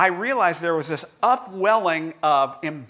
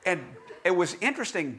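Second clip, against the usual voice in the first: ordinary talk, nothing marked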